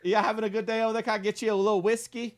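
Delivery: high-pitched hammed-up Southern host; Southern accent. Offering a little whiskey, exaggerated Southern accent. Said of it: "Mine starts going Southern".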